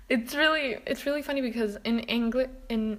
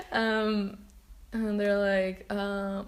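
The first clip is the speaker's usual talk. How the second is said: ordinary delivery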